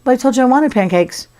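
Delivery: high, put-on plaintive voice; mock-worried little complaint; concerned. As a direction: act mildly hurt and let down. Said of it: "Now he's concerned".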